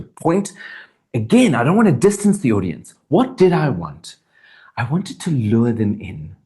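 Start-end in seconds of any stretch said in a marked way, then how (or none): none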